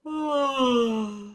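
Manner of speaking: long sleepy sigh; tired sigh. Acted. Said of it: "The axolotl is so, so tired".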